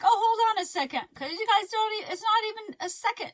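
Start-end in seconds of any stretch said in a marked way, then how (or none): 0.0-1.1 quick, higher and louder mock "hold on"; tossing off a fake hurried excuse. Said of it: "So funny when we're like".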